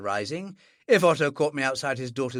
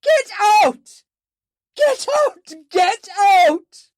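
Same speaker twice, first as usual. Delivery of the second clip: very high, loud screaming; 11 dB louder, slower than usual. Acted. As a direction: scream him out of the room. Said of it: "a feminine voice scream".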